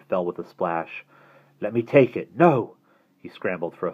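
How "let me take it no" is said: urgent cry. A narrator's reading. Scramble on rocks, grabbing Grail.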